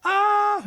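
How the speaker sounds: a short mock scream, pitched high; imitated death scream. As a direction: throw out one sudden high shriek. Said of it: "j'y lâche un cri de mort".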